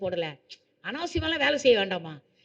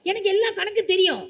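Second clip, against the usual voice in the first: proud excited exclaim; 8 semitones higher than usual. Real, not acted. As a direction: crow about just passing. Proudly announcing forty-one marks. (உயர்ந்த உற்சாகக் குரலில் பெருமை)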